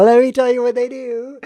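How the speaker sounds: loud, high-pitched mock hype; excited shout. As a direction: shout it like a big reveal. Shouting comic build-up to punchline.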